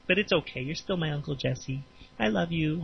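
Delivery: soft, affectionate reassurance; gently, like comforting a friend; tender aside. Real, not acted. Tender aside to Uncle Jesse.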